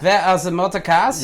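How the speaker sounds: hammed-up German accent; mock German accent. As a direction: ham up a cod German. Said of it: "piss take German accent".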